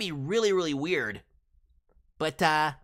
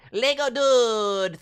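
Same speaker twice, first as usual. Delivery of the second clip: slow drawn call; 5 semitones higher, 6 dB louder, much slower than usual. Acted.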